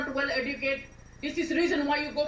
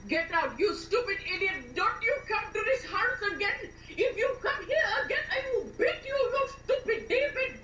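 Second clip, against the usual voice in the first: anger climbing to a high-pitched threat; furious; 5 semitones higher than usual. Acted. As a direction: start firm, then climb into a high tirade. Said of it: "I'm very angry with you".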